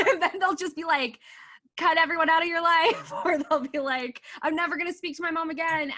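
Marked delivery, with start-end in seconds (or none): 1.8-3.1 louder, higher imitation of drastic advice; hammed-up, emphatic outburst; mock militant advice. Imitating people who urge drastic cuts, mock militant. 4.4-5.9 high, quick imitation of someone overreacting; hammed-up, impulsive vow; mock defiance. Imitating people overreacting to their family, mock defiant.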